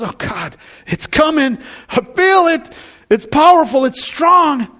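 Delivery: pleading to God as temptation grows, voice climbing. Retold or imitated. Voicing believer under temptation.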